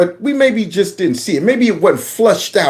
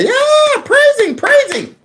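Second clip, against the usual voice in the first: very high, falsetto praise shouts; mock congregation; slower than usual. Mocking the congregation cheering, very high falsetto. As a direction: squeal it like an excited churchgoer. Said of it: "They're in there".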